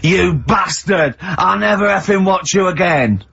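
slow, high-pitched retold insult; mock indignant outburst. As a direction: draw out the outrage, imitating an offended fan. Drunk fan's retold angry parting shot.

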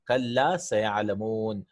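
slow, deliberate recitation of "kalla sayalamun" with a long double l. Shown as a demonstration. Teacher reciting to students, slow and deliberate. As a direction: draw out "kalla sayalamun" like a Quran reading. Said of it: "repeats verse for students".

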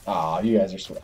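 ordinary talk

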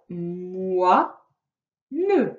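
"moi, ne" said as two syllables with a pause between; split into syllables. Shown as a demonstration. Teacher showing a child the syllables of moine, slow separate beats.

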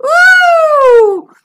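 long, high, loud yell of falling; high loud whoop. Acted. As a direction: whoop as you slide away. Sliding down the tunnel.

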